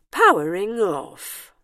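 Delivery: slow, drawn-out machine announcement; robot voice. A narrator's reading. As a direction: announce it slowly as a robot winding down. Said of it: "said the robot".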